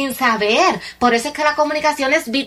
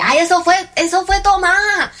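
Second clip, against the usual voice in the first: acted-out exclamation, pitched up; imitating someone piping up; mock exclamation; 6 semitones higher, 3 dB louder than usual. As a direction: exclaim it higher, as if blurting a quote. Acting out someone recognizing who asked.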